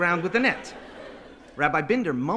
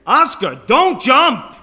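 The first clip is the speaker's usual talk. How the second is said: loud, high cry of alarm; frantic yell up to the roof; desperate pleading shout; 10 semitones higher, 17 dB louder, slower than usual. Acted. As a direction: beg at the top of your voice. Rabbi begs boy not to jump.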